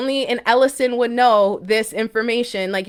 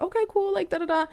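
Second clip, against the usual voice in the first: high mock dismissive okay; 12 semitones higher, 4 dB quieter, faster than usual. Dismissive reaction to psychic.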